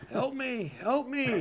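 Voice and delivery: high-pitched desperate squeal. Retold or imitated. Injured stranger begging in the hospital waiting room, high squeal. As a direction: beg for help in a thin, high squeal. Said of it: "he's squealing".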